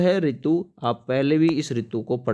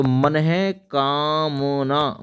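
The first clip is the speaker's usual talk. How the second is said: मनहे कामना drawn out slowly; slow demo; much slower than usual. Shown as a demonstration. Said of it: "विसर्ग के साथ में". (मनहे कामना धीरे-धीरे खींचकर)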